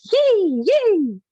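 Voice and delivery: put-on high, loud childish yay, yay; imitating kids squealing. Recalling sisters' play-fight giggles: high childish squeal. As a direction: squeal like a giddy little girl at play.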